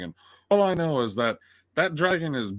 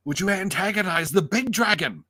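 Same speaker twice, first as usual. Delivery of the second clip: high, raised incredulous rebuke; exasperated scolding; 7 semitones higher, 4 dB louder than usual. Retold or imitated. Viewer berates the rider for attacking, exasperated. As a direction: scold in disbelief, pitch climbing.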